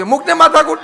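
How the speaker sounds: મૂકને માથાકૂટ tossed off loud with a laugh; exasperated scolding. Acted. Scolding the husband.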